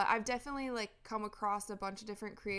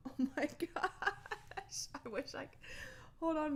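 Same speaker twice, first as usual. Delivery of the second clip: laughing; 6 semitones higher, 4 dB quieter than usual. Real, not acted. Laughing at the dog on camera.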